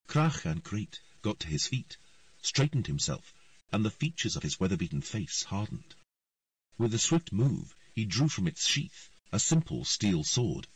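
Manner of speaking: ordinary delivery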